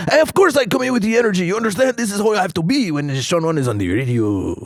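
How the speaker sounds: high, loud, high-energy sidekick; excitable radio character, loud and high; Sean Wan. Shown as a demonstration. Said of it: "you just get in here with this energy".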